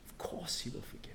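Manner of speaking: whisper. Real, not acted. Reassuring aside to listeners.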